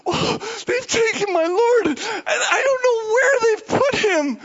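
high, loud crying plea; Mary sobbing loudly at the tomb; tearful wail. Acted. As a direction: wail it out through tears.

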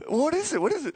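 hammed-up eager begging to know; eager asking. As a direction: play someone dying to find out. Acted receiver eagerly asking.

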